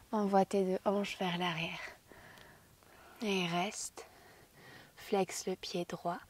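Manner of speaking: ordinary delivery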